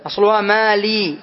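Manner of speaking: usual speaking voice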